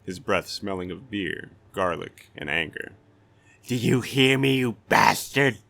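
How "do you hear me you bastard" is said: loud, higher, jeering bully voice; taunting thug. A narrator's reading. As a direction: bait him, loud and mocking. Thug taunts silent stranger.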